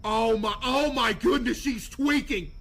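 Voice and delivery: loud amused exclaim. Real, not acted. Streamer reading a suspect's texts, excited. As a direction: blurt it out, amazed.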